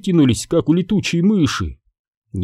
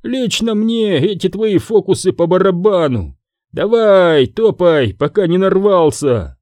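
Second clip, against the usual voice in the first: higher, insolent bouncer voice; mildly taunting, pitched up; dismissive guard; 6 semitones higher than usual. A narrator's reading. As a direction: brush him off scornfully. Guard taunts old man, voiced.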